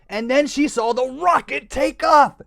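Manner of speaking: plain reading voice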